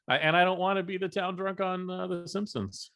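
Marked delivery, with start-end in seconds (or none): none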